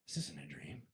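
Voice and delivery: low, soft, slow coaxing voice; quiet low persuading dream voice; dream figure. Retold or imitated. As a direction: lure them to stay, low and hushed. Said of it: "someone always comes up and they're like".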